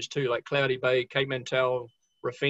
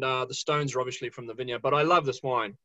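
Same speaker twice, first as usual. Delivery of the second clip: usual speaking voice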